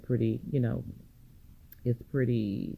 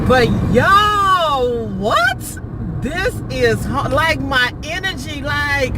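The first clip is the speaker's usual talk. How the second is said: high and loud in disbelief; excited; 6 semitones higher, 3 dB louder, slower than usual. Real, not acted. Vlogger amazed at the country road, loud excited exclamation. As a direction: call out to viewers, thrilled. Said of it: "my energy ... my vibe just go up".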